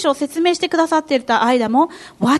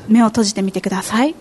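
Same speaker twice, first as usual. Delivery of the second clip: guided meditation; 7 semitones lower than usual. Acted. Interpreter leads meditation.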